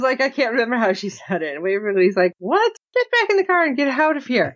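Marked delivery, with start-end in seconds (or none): 2.4-4.5 high, fast indignant dismissal; hammed-up indignant reaction; mock outrage. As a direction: send them packing, pitched way up. Voicing Waverly's outraged reply.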